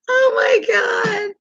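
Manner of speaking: high, drawn-out laughing squeal; helpless laughter. Real, not acted. Host cracking up at a relatable comment, laughing squeal. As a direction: squeal it slowly, overcome with real laughter.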